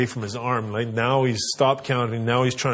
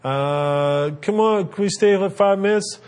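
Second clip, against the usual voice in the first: put-on high, louder kid whine; whining kid; 8 semitones higher, 3 dB louder than usual. Kids whine to dad for five more minutes. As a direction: stretch the come on like a kid.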